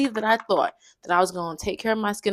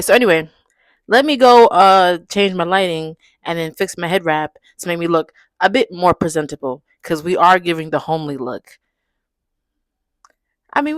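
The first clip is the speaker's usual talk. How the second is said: ordinary talk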